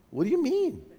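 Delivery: high-pitched puzzled question; acted bafflement. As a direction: replay a clueless, baffled reaction. Acted baffled reply on phone.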